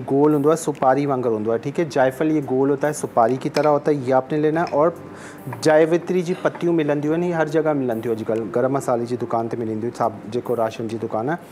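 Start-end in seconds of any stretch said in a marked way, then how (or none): none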